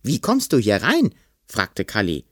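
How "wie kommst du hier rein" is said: put-on high-pitched boy's challenge; alarmed demand. A narrator's reading. Boy questioning the intruder in his room, alarmed high voice.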